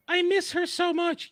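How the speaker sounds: high, slow, whiny mock fan voice. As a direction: whine it like a lovesick fan. Mocking a fan's comment.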